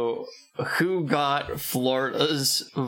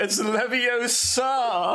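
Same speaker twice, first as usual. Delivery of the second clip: much higher prim correcting voice; Hermione impression; 8 semitones higher than usual. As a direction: correct them like a know-it-all. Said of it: "correct her on her pronunciation".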